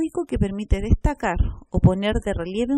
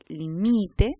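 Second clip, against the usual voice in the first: LIMITE said li-MI-te, MI held; stress on middle syllable; 2 semitones lower than usual. Shown as a demonstration. Teacher tells apart identical words, LIMITE stressed on MI. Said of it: "Nos permite diferenciar palabras fonológicamente idénticas".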